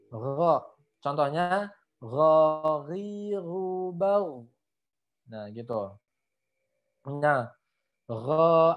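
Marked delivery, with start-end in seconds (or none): 0.1-0.6 Gho with a snore-like throat rasp; ghain berdengkur. Shown as a demonstration. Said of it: "kayak ada ngoroknya". 2.0-4.5 ghariru bau drawn out, gh rasped like a snore; ghain berdengkur. Shown as a demonstration. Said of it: "seperti ada dengkurnya". 8.1-8.7 gho with a snoring rasp; ghain berdengkur. Shown as a demonstration. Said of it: "ada dengkurnya".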